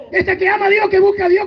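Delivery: chuckling through the retold prayer; a laugh breaking into the words. Pastor recalls praying for his future wife, laughing.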